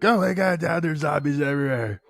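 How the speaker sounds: high, whiny cry of alarm to Dad; hammed-up high-pitched panic; panicked kid. Kids acted screaming about zombies.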